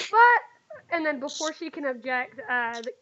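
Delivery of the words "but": put-on loud, high little-girl objection; child's protest. Little girl objecting to the dragon's refusal, high and loud. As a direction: cut in like a pleading child.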